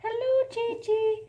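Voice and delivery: high greeting to the dog; pet voice. Real, not acted. Greeting the dog in a high pet voice. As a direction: lift it up sweetly.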